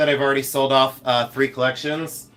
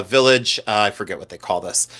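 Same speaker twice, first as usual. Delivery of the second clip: ordinary delivery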